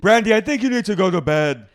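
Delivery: high, loud bossy hostess; imitating Dorinda sending Brandy to bed; mock Dorinda. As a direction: order her to bed like a grand dame. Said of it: "Dorinda's like".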